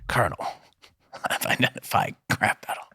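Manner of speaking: whispered soldier radio report; hushed gruff codec call; Snake impression. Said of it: "I can do an okay Snake impression".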